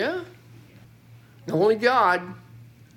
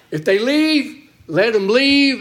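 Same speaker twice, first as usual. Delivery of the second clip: higher, louder pronouncement; forceful preaching; 6 semitones higher, 6 dB louder, slower than usual. Acted. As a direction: declare it with full force. Paul's ruling, preached strongly.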